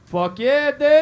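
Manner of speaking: loud, hyped-up agreement; rowdy cheer. Real, not acted. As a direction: shout it to fire up the room. Cheering with the crowd.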